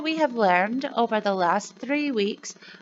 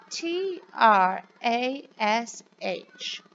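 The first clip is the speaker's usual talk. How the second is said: put-on slow, clear letter-by-letter spelling for children; slower than usual. Shown as a demonstration. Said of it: "can you say it with me".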